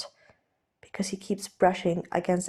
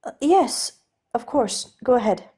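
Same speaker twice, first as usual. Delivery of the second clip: raised, lighter voice for the nervous aristocrat; Steed, flustered gentleman; 5 semitones higher, 4 dB louder than usual. Acted. Steed offering to undo Ed's cravat, nervously polite. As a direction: play Steed, polite and a little jittery.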